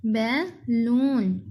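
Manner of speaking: slow balloon with a short gap before "लून"; split into syllables. Shown as a demonstration. Teacher repeating for pupils, balloon slow and split. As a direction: say balloon as two separate beats. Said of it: "इसके अलग-अलग".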